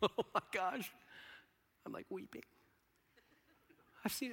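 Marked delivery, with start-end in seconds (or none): none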